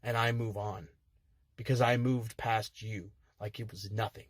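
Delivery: low, soft and settled; cooled down. Real, not acted. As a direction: let the anger drain out. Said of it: "Cooler heads will prevail".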